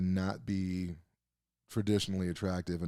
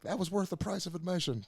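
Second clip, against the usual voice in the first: much higher, giddy fan gush; swooning fan; 12 semitones higher than usual. Retold or imitated. Smitten fan gushing over Nicola Coughlan's two lines, giddy and much higher.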